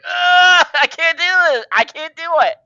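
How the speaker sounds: high, strangled near-sob. Retold or imitated. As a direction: choke out the words, overcome. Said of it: "Like, I'm just like".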